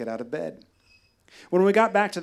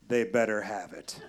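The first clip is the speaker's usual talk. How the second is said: exhausted; 7 semitones lower, 3 dB quieter than usual. Retold or imitated. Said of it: "replied with an exhausted".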